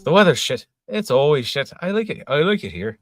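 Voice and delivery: loud, high, hammed-up quote of an Irish mate; quoting Hoey. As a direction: belt it out like a cheery Irishman moaning about the rain. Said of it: "And he goes, he says".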